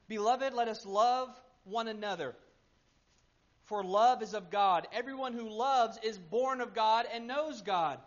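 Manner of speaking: loud, emphatic Bible reading; ringing pulpit recitation; scripture reading. Real, not acted. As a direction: proclaim the verses with full conviction. Reads scripture to congregation.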